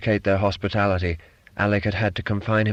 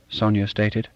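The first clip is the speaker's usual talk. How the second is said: plain reading voice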